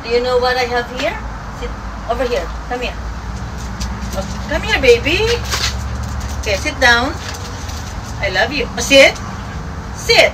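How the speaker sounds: talking to a dog. Real, not acted. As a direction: coo and give commands like to a puppy. Owner coaxing her dog.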